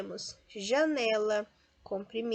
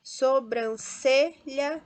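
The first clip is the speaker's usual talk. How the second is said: "Sobrancelha" very slow, CE punched louder; penultimate stress; 3 semitones higher, 5 dB louder, much slower than usual. Shown as a demonstration. Teacher sorts words for students, slow "Sobrancelha" stressed on CE. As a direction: break up "Sobrancelha", hitting "ce". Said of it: "também paroxítona".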